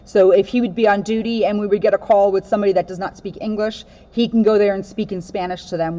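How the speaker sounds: steady storybook read-aloud voice; reading a picture book. Acted. Officer reading a children's book to kids, storybook voice.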